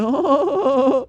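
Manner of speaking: high-pitched mock laugh; put-on laugh shooting way up high; imitated laughing. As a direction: mimic a girlfriend's helpless laughter. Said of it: "sitting there fucking laughing".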